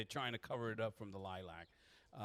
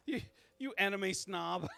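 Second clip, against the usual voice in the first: high, loud, laughing jibe; laughing tease; 10 semitones higher, 6 dB louder than usual. Real, not acted. Mocking a friend for correcting Boruto, laughing. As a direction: tease them through laughter.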